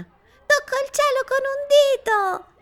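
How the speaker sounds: put-on very high, loud child's cry; gleeful little girl. A narrator's reading. Girl on the swing crying out to the sky, very high and loud. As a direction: squeal up to the sky.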